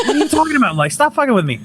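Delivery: high, disbelieving protest; you think your wife is pranking you; high-pitched don't-mess-with-me whine; incredulous. Retold or imitated.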